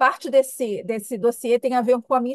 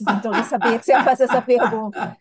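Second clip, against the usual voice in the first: amused; 5 dB louder than usual. Real, not acted. Amused guest admits she expected question.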